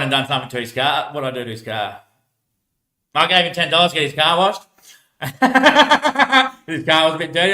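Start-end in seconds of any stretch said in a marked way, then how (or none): 5.2-6.6 high, loud burst of laughter. Real, not acted. Big laugh at own prank.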